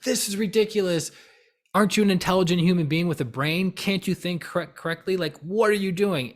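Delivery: high, exasperated scolding; all worked up. Retold or imitated. To someone who still wouldn't get it, worked-up exasperation. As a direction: re-enact an indignant telling-off. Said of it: "who would get all worked up".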